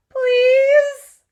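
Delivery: long, loud, high whining please; whiny plea. A narrator's reading. Girl begging her parents to let her go. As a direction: beg like a kid pulling at a parent. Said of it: "the whine that creeps into my voice".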